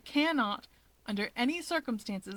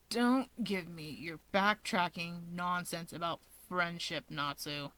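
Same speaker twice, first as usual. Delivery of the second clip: lower, softer and slow; low irritated argument; 4 semitones lower, 5 dB quieter than usual. Acted. Dabi to his brother, arguing in low irritation. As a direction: scold him with cold scorn. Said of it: "Dabi is arguing".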